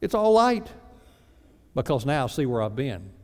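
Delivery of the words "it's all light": amazed. Retold or imitated. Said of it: "I go".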